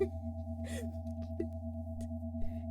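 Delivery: wheezing airless laugh; breathless silent laugh. Real, not acted. Streamer cracking up at the Momo bit, wheezing. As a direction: laugh with no voice left.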